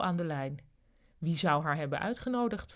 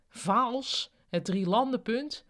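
higher, rising echo question; incredulous question; 5 semitones higher, slower than usual. A narrator's reading. Ruud hears the bus's first stop, incredulous. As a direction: query it in disbelief. Hearer queries an unexpected destination.

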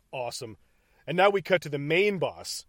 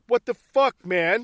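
loud, higher-pitched indignant protest; acted-out indignant outburst; mock outrage; 6 semitones higher, 7 dB louder, slower than usual. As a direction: protest in disbelief as the imitated hero. Acting Bruce's outraged reaction.

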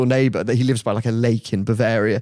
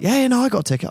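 high, hurried mock eagerness; acted-out eager reply; eager seller; 11 semitones higher, much faster than usual. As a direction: jump in keenly with an offer. Player eagerly offers his ticket.

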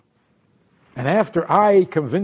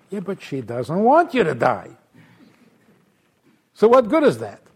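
ordinary delivery

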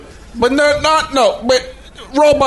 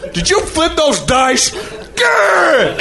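a question, then a high shouted growl; hammed-up growling outburst; angry Watto; 11 semitones higher, much slower than usual. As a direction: snarl at him as mock Watto. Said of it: "He would have come in there".

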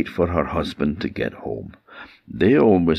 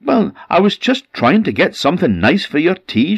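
higher, louder, defensive retort; getting a bit mad; 13 semitones higher, 5 dB louder than usual. Acted. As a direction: snap back in a high voice, a bit mad. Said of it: "starting to get a bit mad herself now".

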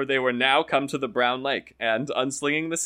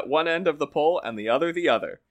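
ordinary delivery, nothing marked

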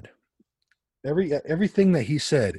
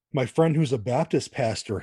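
usual speaking voice

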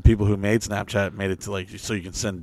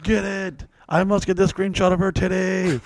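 higher, hammed-up desperate resolve; mock urgent determination; 7 semitones higher than usual. To listeners, mimicking his desperate screenshot grab. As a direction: play the guy straining to grab the screenshot.

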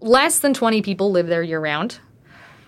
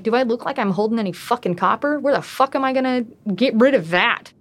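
ordinary talk

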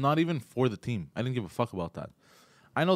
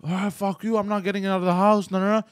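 high, raised-voice defiance; retold screaming; 8 semitones higher, 5 dB louder than usual. Kid refusing to get out of the house, imitated screaming. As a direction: protest loudly in mock outrage. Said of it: "If you keep screaming".

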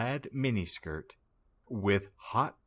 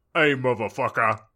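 tough fast greeting; 3 semitones higher, 7 dB louder, faster than usual. Acted. Diabolo greets.

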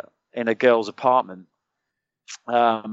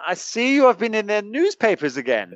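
high-pitched imitation Norwegian accent; put-on Norwegian accent, pitched well up; Norwegian officer; 8 semitones higher than usual. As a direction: mimic a Norwegian liaison officer's English. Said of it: "local liaison officer from the Norwe".

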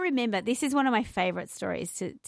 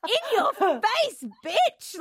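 higher, slow, gloating with a laugh; smug defiance; 12 semitones higher, 3 dB louder, much slower than usual. Retold or imitated. Leaving the party, she gloats to herself after being yelled at. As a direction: rub it in, mock-triumphant. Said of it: "I was so mad".

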